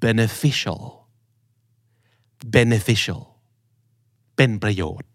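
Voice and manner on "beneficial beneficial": beneficial as "ben-uh-FISH-uhl"; stress on FISH. Shown as a demonstration. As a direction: punch fish, reduce the other vowels of beneficial. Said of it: "เพราะฉะนั้นมันคือ".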